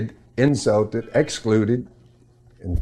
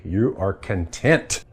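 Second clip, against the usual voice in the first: ordinary delivery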